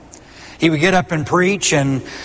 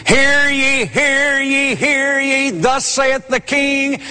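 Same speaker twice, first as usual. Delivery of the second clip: higher-pitched "hear ye" called out like a herald; herald's proclamation; 8 semitones higher, slower than usual. Acted. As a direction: announce it like a royal crier. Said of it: "Acting as a herald".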